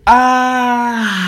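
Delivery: high, loud, celebratory "Ah!"; excited scream. Real, not acted. Podcast host revealing first merch to listeners, excited scream. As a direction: shriek with delight.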